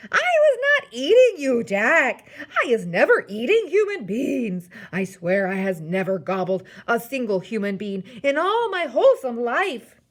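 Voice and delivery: loud, high, frantic protesting; screeched. A narrator's reading. Giant swearing innocence to Jack, screeched protest. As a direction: screech the denials in desperate panic. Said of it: "he screeched".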